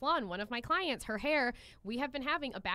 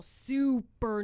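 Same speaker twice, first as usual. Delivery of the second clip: SUPER stretched long and louder; drawn-out emphasis; 5 dB louder than usual. Real, not acted. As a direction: draw out the word "super". Stressing how nervous client was.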